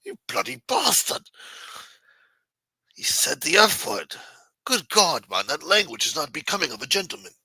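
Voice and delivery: mock posh English accent, scandalised. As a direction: play an appalled English gent clutching his pearls. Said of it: "They scoff at that shit, you know? Like".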